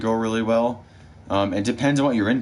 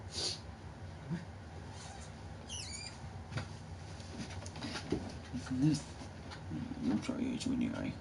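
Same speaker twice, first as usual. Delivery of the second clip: much softer, hushed comforting of a pet; soft soothing; 18 dB quieter, faster than usual. Real, not acted. To her dog Luce, hurt in the eye, hushed soothing apology. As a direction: murmur sorry to the hurt dog.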